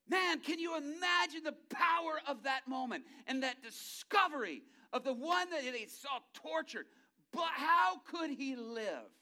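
high, loud, shouting sermon climax; fiery preacher at the peak; full-force preaching. Real, not acted. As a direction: preach it with everything you have.

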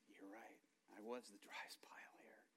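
very soft, half-whispered; hushed humble admission. Retold or imitated. Preacher answering the Lord about the driest pile, hushed. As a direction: admit it quietly, almost to yourself.

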